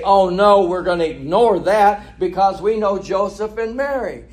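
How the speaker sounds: high, loud mock refusal; mock dismissive. The crowd rejecting Jesus's claim, imitated as mock dismissive. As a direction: wave the proof away as the stubborn crowd.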